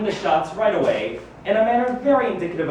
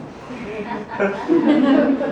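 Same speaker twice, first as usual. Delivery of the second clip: a long, slightly louder laugh; pleased laugh; 4 semitones higher, 3 dB louder than usual. Acted. Hearing his mind called impressive, a pleased laugh. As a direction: laugh with flattered amusement.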